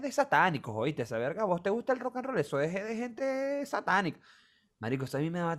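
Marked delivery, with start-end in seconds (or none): 1.4-4.2 mocking preachy parent. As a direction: drag it out like a scandalized parent.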